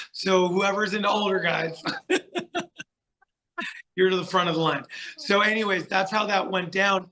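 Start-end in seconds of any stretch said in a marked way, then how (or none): 0.1-4.0 laughing through the punchline. Real, not acted. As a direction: chuckle the words out, fast at the end. Laughs at own joke.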